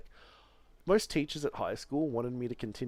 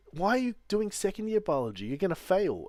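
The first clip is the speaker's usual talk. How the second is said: exasperated professor; 9 semitones higher, 5 dB louder than usual. Retold or imitated. Professor voiced in retold story.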